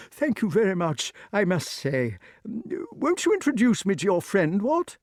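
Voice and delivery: high, fluting polite knight; courteous dotty king. Acted. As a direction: ask politely in a high eccentric king's voice. Voices polite King Pellinore.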